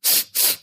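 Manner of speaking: a quick, sharp sniff through the nose; short nasal sniff shown as an example; demo sniff. Shown as a demonstration. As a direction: sniff once, the way a tic would. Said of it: "I would also sniff like".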